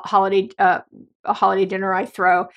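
normal talking voice

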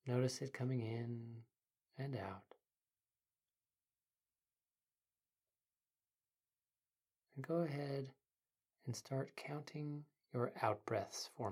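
soft, quiet meditation guide; sleep story narrator. Acted. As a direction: ease the listener into calm.

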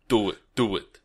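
lightly voiced burly movie tough guy; a hint of a burly guy's low-key command. Podcaster quoting a film to co-host, lightly voiced burly guy. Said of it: "this burly guy, and he says".

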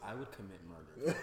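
low, deadpan mock menace; legitimate threat. Podcaster imitating the rider's threat, low and serious. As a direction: drop low and mean it. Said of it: "That was a legitimate threat".

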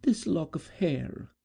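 high, slow, fragile voice; gentle maiden. Acted. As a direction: Edith naming the token. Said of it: "said Edith".

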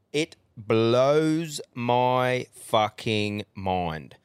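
very slow, drawn-out words; exasperated emphasis. Real, not acted. Ranting to mates about a show host, very slow exasperated emphasis. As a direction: stretch every word out in disbelief.

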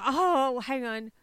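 normal talking voice

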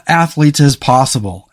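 normal talking voice

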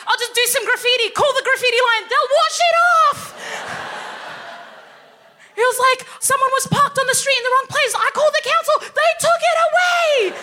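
high, loud, thrilled and laughing; proud dad raving about services; gushing dad. Retold or imitated.

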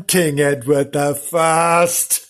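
slow, pompous announcer reveal; stately slow announcement; grand reveal. Acted. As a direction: unveil the name slowly like a fanfare. Dramatic reveal with music.

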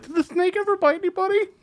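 very high mock child voice; little kid. First question at the convention Q and A, little kid voice. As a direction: squeak it out like a curious little kid. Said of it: "like, some little kid".

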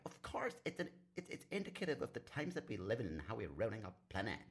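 very high put-on voice, easing down; Greta Thunberg. Activist lecturing on ruining our planet, hammed-up impression. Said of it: "especially if you ask Greta Thunberg".

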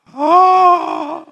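loud, high drawn-out "Ah!"; shocked cry. Retold or imitated. Preacher re-enacting shock at the tithe amount, loud cry. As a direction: cry out in theatrical amazement.

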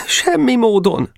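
high, slow, desperate plea; pleading question. A narrator's reading. Geréb asking to be taken back, pleading.

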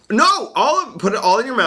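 high, loud and fast; excited yell. Real, not acted. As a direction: push them to stuff it all in. Insisting all Cheetos go in.

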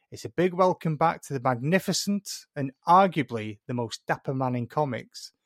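normal talking voice

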